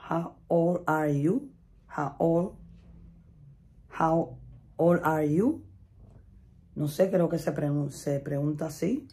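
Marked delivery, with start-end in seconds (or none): 0.1-5.6 a learner carefully spelling out the question; slow, deliberate "How old are you?" with a rising end; slow drawn-out English. Shown as a demonstration. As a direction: stretch each word slowly, rising at the end.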